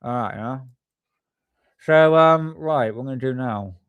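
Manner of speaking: talking around a pen held in the mouth; pen in teeth. Real, not acted. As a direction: mumble through a pen between the teeth. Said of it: "I'm gonna stick the pen in my mouth".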